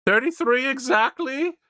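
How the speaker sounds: high teasing question; mock incredulous. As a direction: push it up into mock disbelief. Teasing mishearing again.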